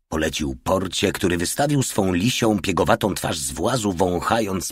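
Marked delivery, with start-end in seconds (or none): none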